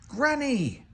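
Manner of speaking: high, glad cry of surprise; surprised cry. Acted. Big Bird spotting Granny in the laundromat, high and thrilled. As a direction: cry out at the sight of her. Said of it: "Big Bird cried".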